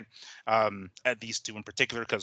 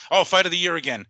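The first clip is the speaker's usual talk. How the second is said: sarcastic mock gush, a little louder; mocking quote; 5 semitones higher, 7 dB louder than usual. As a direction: parody the hype, slightly raised. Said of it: "going to social media talking about".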